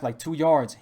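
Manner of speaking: ordinary talk, nothing marked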